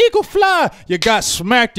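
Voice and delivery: loud, high gloating jeer; gloating taunt. Real, not acted. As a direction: rub the loss in their face. Taunting rival team's loss.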